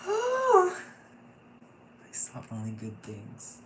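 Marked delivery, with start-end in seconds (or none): 0.1-0.7 high, loud emotional sigh; big sigh. Real, not acted. Reader moved by the session, loud emotional sigh. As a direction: let out a heavy sigh of relief.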